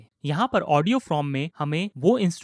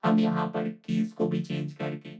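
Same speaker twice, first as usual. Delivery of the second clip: synthetic, robotic vocoded speech; vocoder effect. Shown as a demonstration. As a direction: talk as a keyboard-driven vocoder. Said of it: "vocoder effect मिल रहा है".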